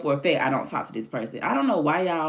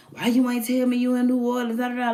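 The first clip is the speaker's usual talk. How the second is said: a hammed-up, higher whiny guy demanding answers; mock dude voice; 10 semitones higher than usual. Retelling the guy's text, mocking his entitled whine. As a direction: imitate him, put out and needy. Quoting the dude who texted.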